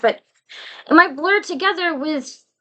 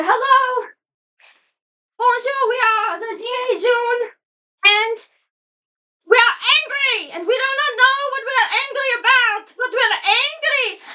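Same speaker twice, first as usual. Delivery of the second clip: much higher, comic skit voice with a French lilt; mock angry protester skit; 11 semitones higher than usual. French couple opening their Christmas party skit, higher hammed-up protester voice. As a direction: ham up a French protester chanting their grievance. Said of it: "they opened their party with a skit".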